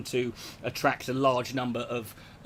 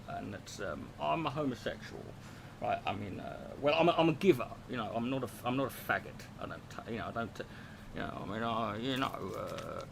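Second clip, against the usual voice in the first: soft mumbling London gangster, much quieter; Ronnie Kray mumble; 4 dB quieter than usual. Retold or imitated. Imitating Ronnie Kray confessing to his brother, quiet mumbling. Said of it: "he talks like this 'cause he's, he's mumbling all the time".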